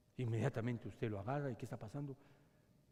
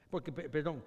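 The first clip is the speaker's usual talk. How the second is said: ordinary delivery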